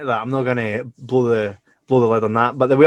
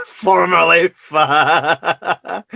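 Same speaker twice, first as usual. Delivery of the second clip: drawn-out words breaking into laughter; slow, high, gleeful jibe; laughing tease; 5 semitones higher, 6 dB louder, much slower than usual. Real, not acted. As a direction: stretch it out and crack up. Mocking the co-host, laughing.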